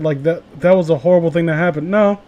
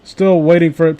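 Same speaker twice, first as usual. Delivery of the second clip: usual speaking voice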